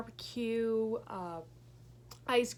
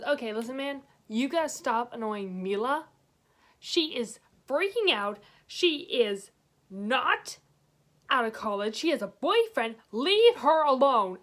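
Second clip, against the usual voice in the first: stern warning; 8 dB louder than usual. Retold or imitated. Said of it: "And he goes".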